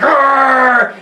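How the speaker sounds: loud high Wookiee growl; Chewbacca roar. Retold or imitated. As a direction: roar like Chewie. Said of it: "Chewie's like".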